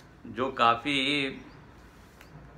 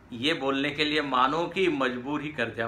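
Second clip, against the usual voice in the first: ordinary delivery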